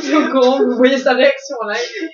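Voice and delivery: laughing loudly. Real, not acted. Laughing about his reaction.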